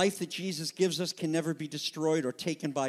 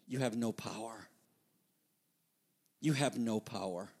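a soft, calm, much quieter line; hushed quiet; 7 semitones lower, 8 dB quieter than usual. Acted. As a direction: say it softly, with quiet authority. Jesus answering Pilate, quoted quietly.

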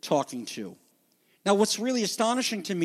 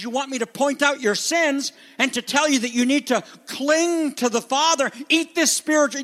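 upset Jesus voice; 6 semitones higher than usual. Acted. Jesus confronting the crowd, upset and rising. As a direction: press them as an upset Jesus. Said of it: "He's like".

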